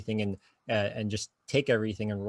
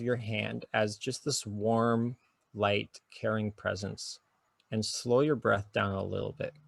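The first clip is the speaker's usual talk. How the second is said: guided meditation. Shown as a demonstration. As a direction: talk them gently into noticing their breath. Leads a short guided exercise.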